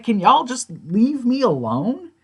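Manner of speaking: high, drawn-out mock plea; exasperated pleading. Jim begging the group to back off, exasperated. Acting out man fed up with group.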